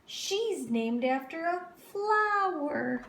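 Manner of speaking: mocking girl. A narrator's reading. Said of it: "said Victoria".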